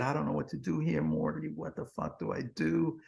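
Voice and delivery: high, soft, hurried plea with a mild sob; retold crying. A hint of the boss crying to his friend about the job, pleading softly. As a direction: echo a friend falling apart, softly and fast. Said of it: "he broke down crying".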